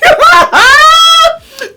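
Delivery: very high, loud screaming laugh; shrieking laugh. Real, not acted. Laughing loudly at the scene.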